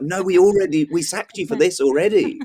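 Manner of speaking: high, exasperated mock club bosses. Joker mimics football club sacking the fascist again, exasperated. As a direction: squeal it like fed-up officials.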